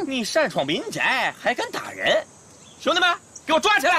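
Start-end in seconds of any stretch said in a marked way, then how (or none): 2.8-4.0 barked order. Acted. Officer orders arrest.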